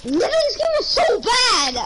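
raging gamer. Real, not acted. Outrage at teammate's no damage.

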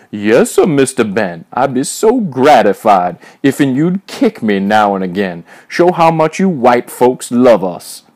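loud, hammed-up grateful grovelling; old-time servant imitation. A narrator's reading. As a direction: fawn loudly in a mocking servant voice. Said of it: "as Major continued his imitation".

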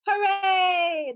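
high, loud gleeful cheer; excited cheer. A narrator's reading. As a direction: cheer like a kid at a party. Narrator cheering monster day.

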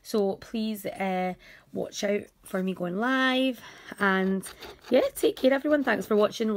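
ordinary talk

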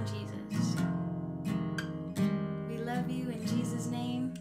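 ordinary talk, nothing marked

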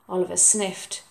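ordinary delivery, nothing marked

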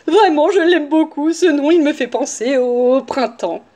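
sugary, gushing voice; extra-kind wolf. A narrator's reading. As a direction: pile on the exaggerated sweetness. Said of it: "d'une voix encore plus gentille".

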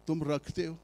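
ordinary talk

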